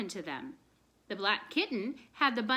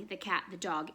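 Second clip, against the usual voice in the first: ordinary delivery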